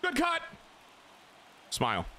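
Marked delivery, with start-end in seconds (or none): 0.0-0.4 high, peppy teammate shout-out; encouraging call. Pretend. Player encouraging his teammate, high peppy call. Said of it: "I gotta encourage my teammate".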